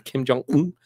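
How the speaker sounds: a playful hint of a Korean accent on "Kim Jong-un"; mock Korean accent. Shown as a demonstration. Explaining the joke to his friend, with a light mock Korean accent. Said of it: "I put an emphasis on that accent".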